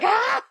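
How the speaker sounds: high laughing yelp of a startled dog. Retold or imitated. Imitating the dog held in midair, high laughing yelp. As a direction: squeal like the dangling dog. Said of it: "the dog being held in midair".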